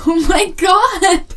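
high, slow excited shout; loud. Acted. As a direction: scream it in starstruck awe. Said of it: "I'm sorry I'm being loud".

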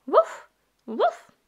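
high, loud puppy woof; puppy bark. Acted. Voicing the puppy Biscuit barking.

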